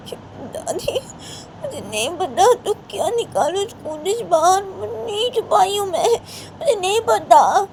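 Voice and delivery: put-on high, tearful child's voice, sobbing out denials; a little girl crying and pleading innocence. Crying child denies hiding dress.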